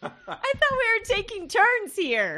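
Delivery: high, loud mock indignation; playfully outraged at the cheat; indignant. Real, not acted. Mock outrage at second bite.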